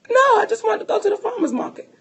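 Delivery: high, quick mock-crying whine; imitated tearful protest. Crying wife to her husband, mock-tearful protest. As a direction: plead like an upset little girl, high and hurried. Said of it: "I'm, I'm full-fledged crying".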